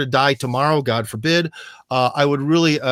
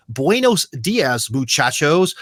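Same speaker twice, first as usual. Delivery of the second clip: slow, trying-hard American tourist Spanish; clumsy American-accented Spanish; slower than usual. Retold or imitated. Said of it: "if a guy goes up to someone who's Mexican".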